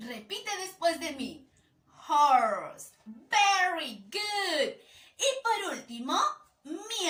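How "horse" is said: Horse said slowly and clearly, stressed; model pronunciation. Shown as a demonstration. Teacher models Horse for the child to repeat. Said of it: "Repeat after me".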